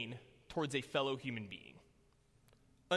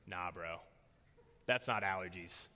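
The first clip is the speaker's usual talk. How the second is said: much lower and softer, flat verdict; deadpan bro; 12 semitones lower, 6 dB quieter than usual. Retold or imitated. Fraternity brother inspecting the eye, low and soft. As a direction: drop it low and quiet like a blunt friend.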